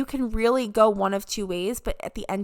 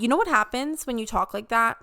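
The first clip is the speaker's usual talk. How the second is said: normal talking voice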